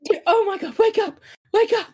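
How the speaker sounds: high, loud, desperate pleading; frantic father. Acted. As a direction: cry out in panic to rouse an unconscious child. Father begging unconscious daughter to wake.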